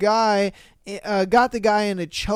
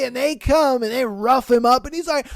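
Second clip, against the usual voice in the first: ordinary talk, nothing marked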